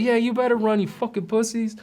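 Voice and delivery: imitating a hothead yelling after them; mocking yell at retreating rivals; jeering taunt. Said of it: "And he's like".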